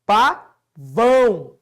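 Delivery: Pavão split into PA, VÃO with a clear gap; two long, loud, separate syllables in Pavão. Shown as a demonstration. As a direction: sound out Pavão very slowly, syllable by syllable. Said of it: "Só tem duas sílabas".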